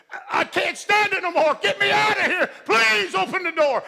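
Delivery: high, loud begging shout; desperate pleading. Retold or imitated. Second soldier in the dark begging, shouting high. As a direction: plead at full voice to open the door.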